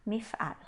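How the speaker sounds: "mif'al" softly, with A in the last syllable; repeated reading. Shown as a demonstration. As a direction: say "mif'al" quietly, ending on A. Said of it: "a gente lê como um A". ("mif'al" mais baixo, com A no final)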